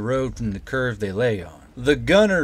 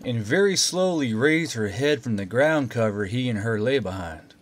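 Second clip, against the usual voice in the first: ordinary delivery, nothing marked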